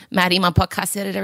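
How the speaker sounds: usual speaking voice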